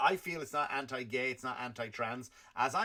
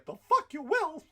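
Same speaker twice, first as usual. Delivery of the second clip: mock outraged shout; 14 semitones higher, 6 dB louder than usual. Retort to Russia, shouted.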